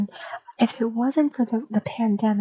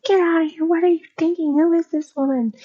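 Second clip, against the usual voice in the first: high, loud scolding yell; furious mom; 6 semitones higher, 5 dB louder than usual. Retold or imitated. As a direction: yell at your son like he's lost his mind. Furious mom yelling at son.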